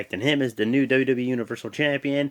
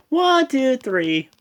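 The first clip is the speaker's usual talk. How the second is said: referee count; 12 semitones higher, 5 dB louder, much slower than usual. Retold or imitated. Calling the pinfall count.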